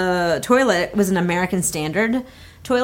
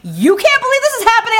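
high, loud mock indignation; outraged incredulity; 14 semitones higher, 5 dB louder than usual. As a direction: throw it back at him in a hammed-up screech. Her outraged echo of the guy.

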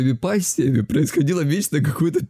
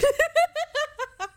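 high wheezy fit of laughter; laughing; 4 dB quieter than usual. Real, not acted. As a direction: crack up helplessly at the story. Laughs at his own grandma story. (заливистый смех)

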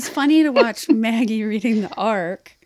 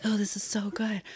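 hushed, near-whispered rave; hushed delight; 10 dB quieter than usual. Retold or imitated. Recalling first reading the book, hushed delight. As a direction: breathe it softly, savoring it. Retold awed reaction while reading.

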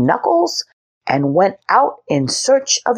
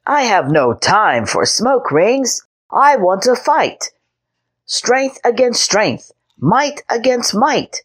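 put-on high, eager little boy insisting; young knight brushing off the dragon; 6 semitones higher than usual. A narrator's reading. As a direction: demand a fight like a proud child. Henry's boyish voice.

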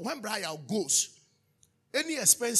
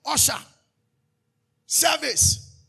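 louder and louder, calling out each word; rising preacher shout; 4 semitones higher, 8 dB louder, slower than usual. Acted. Pastor rallying the congregation, rising shout. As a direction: build each one to a ringing shout.

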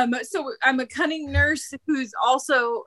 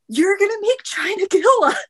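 ordinary talk, nothing marked

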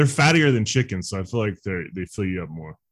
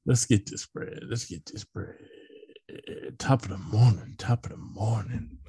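sinks to a breathy whisper; fading whispered echo; 3 semitones lower, 9 dB quieter than usual. Pretend. Friend whispers the song hook, fading to nothing. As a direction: whisper the hook as it fades away.

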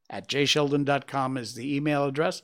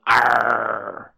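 hammy pirate voice, louder Arr; 4 semitones lower, 4 dB louder, much slower than usual. Pretend. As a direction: ham it up like a pirate. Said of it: "Give me a chance to go".

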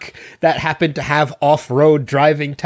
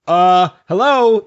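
sarcastic hello; 5 semitones higher, 4 dB louder, slower than usual. Retold or imitated. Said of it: "I was like".

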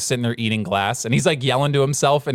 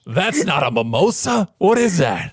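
mock pushy drinker; 6 semitones higher than usual. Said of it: "They're like".

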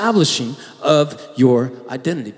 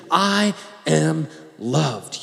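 slow and soft, every word weighed; a quiet, slow self-affirmation; slow tender declaration; 6 dB quieter, much slower than usual. Shown as a demonstration. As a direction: say it gently to yourself. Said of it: "preach this to your own heart".

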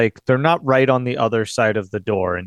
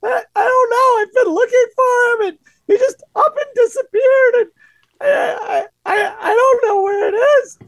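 much higher, louder sobbing pleas; crying back; 5 dB louder than usual. Acted. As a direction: wail it out, desperate and tearful. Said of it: "just crying back at him".

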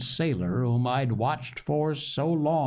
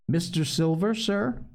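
higher, rising polite question; 5 semitones higher than usual. A narrator's reading. Boy asks landlord if he is Silver, questioning. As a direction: ask it upward, a little tentatively. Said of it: "I asked".

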